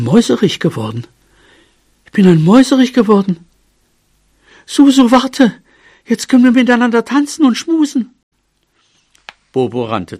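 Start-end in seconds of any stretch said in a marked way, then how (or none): 2.1-3.4 high, fast burst of delight; thrilled, high-pitched little cry; excited exclamation. A narrator's reading. Transformed mouse exclaims it again. 4.6-8.1 very high, excited mouse voice; thrilled and high-pitched; joyful calling out. A narrator's reading. Mouse calls joyfully to his beloved.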